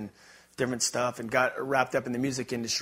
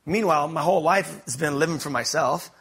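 usual speaking voice

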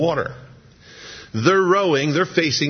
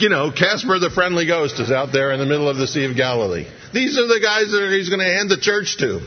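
ordinary talk, nothing marked

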